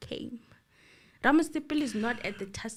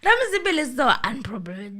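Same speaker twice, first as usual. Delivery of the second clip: said out louder and faster; louder, faster irony; 3 semitones higher, 6 dB louder, faster than usual. Real, not acted. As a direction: push the irony out loud. Ironic remark repeated louder.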